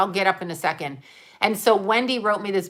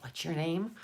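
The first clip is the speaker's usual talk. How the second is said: quieter, offhand imitation of a clerk; imitated absent-minded clerk; 2 semitones lower, 11 dB quieter than usual. Post office guy looking at the package, imitated quiet asking. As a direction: mimic a clerk asking under the breath. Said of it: "He goes like this".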